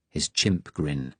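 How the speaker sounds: plain reading voice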